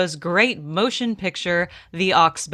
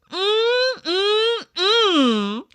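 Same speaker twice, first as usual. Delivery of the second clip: high, drawn-out yummy hums; a pitchman's over-the-top mm of delight; savoring mm; 12 semitones higher, much slower than usual. Acted. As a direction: hum like it's the best thing you've tasted.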